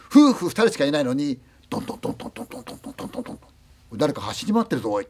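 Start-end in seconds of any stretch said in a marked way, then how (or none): 1.7-3.4 breathy rapid "トントン" for running feet upstairs; whispered footsteps. Retold or imitated. 二階で子供が走り回る足音. (ささやき声の足音)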